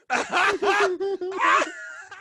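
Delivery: a big shouted belly laugh; howling laugh. Retold or imitated.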